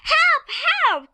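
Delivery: very high-pitched call for help; acted panicky hippo cry; frightened cry. A narrator's reading. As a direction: squeal it out in alarm. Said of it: "cried the hippo".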